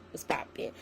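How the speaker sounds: normal talking voice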